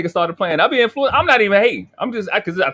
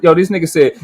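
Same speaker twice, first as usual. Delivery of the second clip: usual speaking voice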